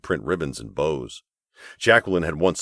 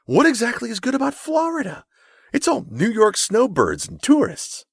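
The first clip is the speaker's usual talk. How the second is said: much higher, louder, sniffy and appalled; appalled disgust; 4 dB louder than usual. A narrator's reading. Snobbish guest scoffing at the bride's honeymoon plan, appalled. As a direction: recoil at the very idea of Florida. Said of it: "look of disgust turn to one of just appalled".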